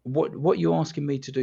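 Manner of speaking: retold fuming. Hypnotist to caller seeking help, retold exasperation. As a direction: demand an answer, mildly exasperated. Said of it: "I was absolutely fuming".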